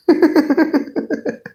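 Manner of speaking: high-pitched helpless laugh; long, high giggle fit; real laughing fit. Real, not acted. Breaking up mid-joke, high helpless laughter.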